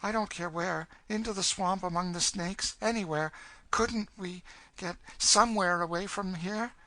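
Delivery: put-on high-pitched girl, pleading; desperate young girl. Girl begging her companion to escape, high desperate pleading.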